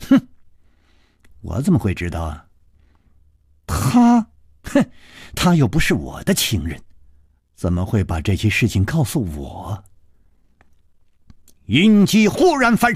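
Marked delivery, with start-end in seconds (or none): 0.1-9.8 scornful huff, then low dismissive denial; sneering. A narrator's reading. Woman coldly denies knowing her rival's friend, sneering. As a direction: deny it coldly with a contemptuous sniff. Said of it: "咬着嘴唇冷笑着说".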